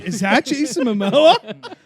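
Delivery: loud, high, drawn-out disbelief; gawking, half-laughing question; astonished double take. Retold or imitated. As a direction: retell it as an amazed exclamation. Acting out his triple take.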